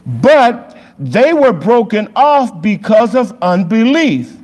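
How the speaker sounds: high, slow, loud preaching read; scripture reading. Acted. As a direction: proclaim the verses slowly and high.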